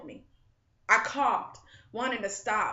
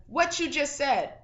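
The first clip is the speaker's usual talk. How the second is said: stern demand; 6 semitones higher, 6 dB louder than usual. A narrator's reading. Mustached man demanding repetition.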